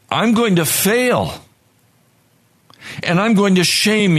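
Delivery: high, pleading cry to God for rescue; desperate prayer. Acted. Tempted believer begging Jesus for deliverance, high and pleading. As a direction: beg for deliverance as if drowning.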